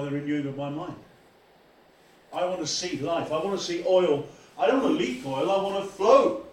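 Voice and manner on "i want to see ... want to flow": rising from usual voice to loud cry; building fervour. Real, not acted. Preacher longs aloud for oil to flow, swelling fervour. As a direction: build each wish louder, peaking on the last.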